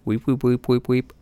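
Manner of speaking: tape rewinding; rewind sound. Shown as a demonstration. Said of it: "That's my rewind sound".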